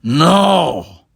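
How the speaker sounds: the captain refusing to concede, emphatic. Acted. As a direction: slam it down defiantly. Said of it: "managed an emphatic".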